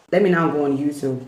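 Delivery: ordinary talk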